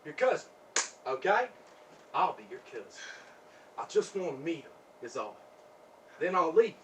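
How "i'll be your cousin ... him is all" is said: low, soft coaxing; quiet coaxing. Acted. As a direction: drop low and soft to bargain. Pleads to meet her date.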